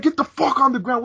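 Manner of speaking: high mock cop yell; retold cop shouting at kids. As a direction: bark it like an angry cop. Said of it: "He's like".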